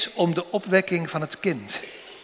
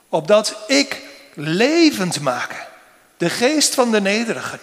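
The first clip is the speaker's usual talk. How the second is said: lifted, emphatic voice for God's words; solemn preaching; 3 semitones higher than usual. Acted. Preacher voicing God's words from Isaiah to the congregation, solemn and raised. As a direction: proclaim it with weighty solemnity.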